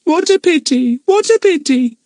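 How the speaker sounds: high, squawky, sorrowful bird voice; mournful parrot. A narrator's reading. Kiki the parrot calling mournfully out of the dark cave. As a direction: lament like a sad parrot. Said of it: "came a mournful voice".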